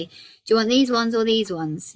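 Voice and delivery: hammy demo of a vague offer; exaggerated wrong-way example. Shown as a demonstration. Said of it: "We never say".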